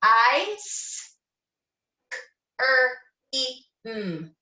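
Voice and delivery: each sound of ice cream said apart; sounded out letter by letter. Shown as a demonstration. Teacher sounding out a word for kindergarteners, one sound at a time. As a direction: segment the word into separate sounds for the kids. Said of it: "We have".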